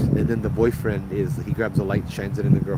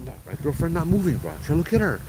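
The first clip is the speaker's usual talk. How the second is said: higher, faster pleading; panicked boyfriend; 6 semitones higher, faster than usual. Retold or imitated. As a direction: imitate a freaked-out boyfriend begging. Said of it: "starts kinda freaking out".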